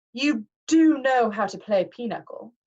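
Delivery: slow, condescending questioning; lightly voiced pompous Mister D. A narrator's reading. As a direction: talk down to the boy, slowly. Mister D's voice.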